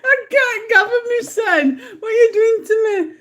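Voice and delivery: high, helpless tearful laughter; laughing to tears. Real, not acted. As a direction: struggle to speak through real laughter. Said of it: "I can't see for tears".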